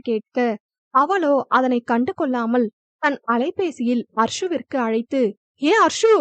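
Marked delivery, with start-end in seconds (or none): 5.6-6.1 higher, louder anxious cry of a name; anxious call. A narrator's reading. She phones her friend in a panic, higher and louder. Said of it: "பதட்டமாய் பேச".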